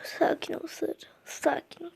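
hushed, telling herself to calm down; whispered self-calming. Real, not acted. Girl calming herself mid-race, whispered. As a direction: breathe it to yourself under your breath.

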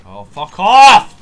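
explosive, drawn-out yell; real full-volume cursing; furious shout. Real, not acted. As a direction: explode at the person interrupting you. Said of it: "how much I've been shouting".

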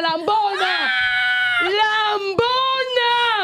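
a mocking shout stretched out slowly; insulting shout. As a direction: hurl the insult at her, drawn out. Insulting absent rival.

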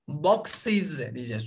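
boxes yavaş, sonda ız heceli boksız; boxes: es'yi ayrı ız hecesi yap; boxes okunuşu. Shown as a demonstration. (ek hecesi belirgin boxes)

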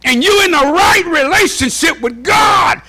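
high, driving pulpit shout; impassioned sermon delivery; full-force preaching. Real, not acted. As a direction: preach it out to the whole church.

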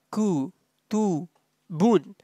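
Ku, Tu, Bun softly, each on its own; syllable by syllable. Shown as a demonstration. Teacher showing students each sound, syllable by syllable. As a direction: separate Ku, Tu and Bun with clear gaps. Teacher spells out, then joins.

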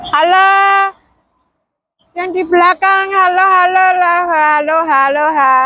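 calling out. Real, not acted. Calling to people at the back.

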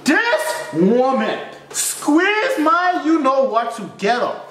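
high, slow, drawn-out indignation; lightly hammed-up shock at the nurse; mock outrage. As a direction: stretch it out, aghast. Outraged retelling of the swab.

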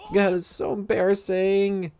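slow, high, cringing; embarrassed. Acted. Caught confessing aloud, slow high embarrassment. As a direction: groan it out, mortified.

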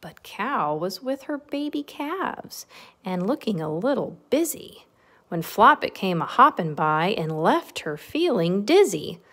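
lilting, animated read-aloud; bouncy storybook narration; story reading to children. A narrator's reading. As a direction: read a rhyming picture book to little ones. Reads picture book to children.

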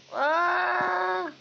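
sudden high battle shout; power up scream. Real, not acted. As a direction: yell out as the hero powers up. Sudden shout during a fight.

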